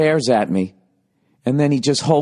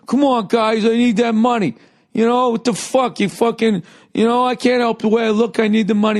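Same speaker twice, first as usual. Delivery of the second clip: high-pitched mock pleading yell; high, worked-up mock protest; retold yelling; 11 semitones higher than usual. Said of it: "he's yelling over the top".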